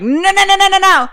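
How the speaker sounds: high, loud rapid-fire refusal; urgent stopping. Acted. Babysitter grabbing running kids, urgent loud no. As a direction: stop them in their tracks. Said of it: "Anna grabs all of them and is like".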